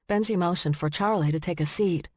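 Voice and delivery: usual speaking voice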